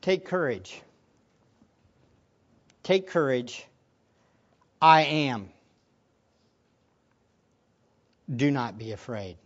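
low, soft, measured reassurance; words of Jesus. Acted. Jesus to frightened disciples, quietly reassuring. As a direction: say it quietly, with long pauses. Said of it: "then comes these words from Jesus".

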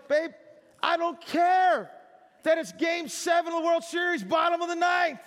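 a boyfriend overplaying his devotion to her; mock devoted boyfriend. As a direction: gush over her day like you'd skip the big game. Devoted boyfriend to dating girlfriend.

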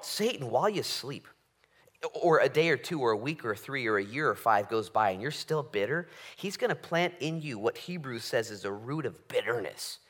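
ordinary delivery

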